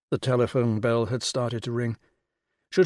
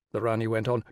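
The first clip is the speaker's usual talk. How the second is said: usual speaking voice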